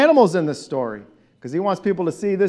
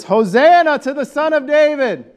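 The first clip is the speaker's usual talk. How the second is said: loud, higher call for the crowd to echo; shouted call; 9 semitones higher, 5 dB louder than usual. Shown as a demonstration. Pastor leading the congregation's shout, loud call. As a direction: call it out big so they can repeat it. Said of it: "So it shouted like this".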